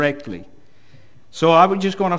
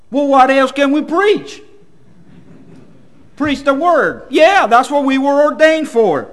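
raised, high and loud exclamation; emphatic reaction; 10 semitones higher, 8 dB louder than usual. Retold or imitated. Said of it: "I was like".

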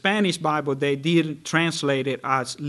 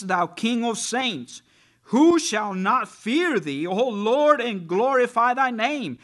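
fervent scripture reading; 6 semitones higher, 3 dB louder than usual. Acted. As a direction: proclaim the verses like a preacher, climbing. Reads scripture aloud with rising fervour.

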